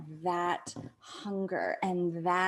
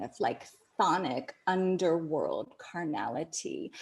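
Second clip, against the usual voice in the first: ordinary delivery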